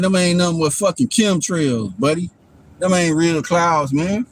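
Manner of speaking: ordinary talk, nothing marked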